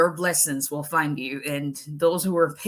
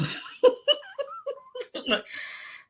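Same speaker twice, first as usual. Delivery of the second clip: high laugh breaking into a cry; mother laughing at her own urge to shout; laughing at herself; 4 dB quieter than usual. Real, not acted. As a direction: crack up at herself.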